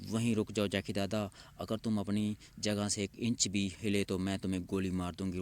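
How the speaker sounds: ordinary delivery, nothing marked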